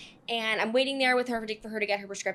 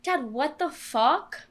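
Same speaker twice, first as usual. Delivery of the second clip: outraged; 4 semitones higher, much slower than usual. Retold or imitated. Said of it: "And I was like".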